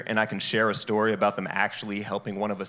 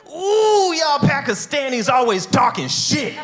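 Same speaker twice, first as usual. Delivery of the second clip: put-on much higher, louder, squealing Indian accent; Indian uncle voice; 5 dB louder, slower than usual. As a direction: burst out as a riled Indian auntie. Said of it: "Something came over me".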